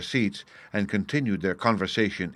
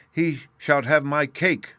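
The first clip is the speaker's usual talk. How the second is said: passionate cry; 6 semitones higher, slower than usual. A narrator's reading. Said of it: "Bruno cried, passionately".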